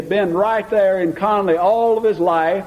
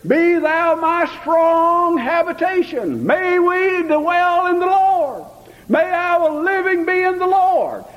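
preaching; 6 semitones higher than usual. Acted. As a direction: lift the voice and preach it to the congregation. Preacher rises into full preaching.

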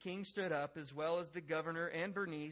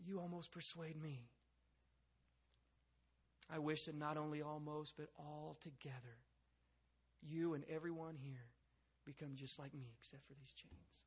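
soft, measured, fading almost to a whisper; hushed powerful moment; 7 dB quieter than usual. Acted. Agrippa and Paul in a powerful moment, hushed. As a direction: let it sink into silence between phrases. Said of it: "this had to be a powerful moment".

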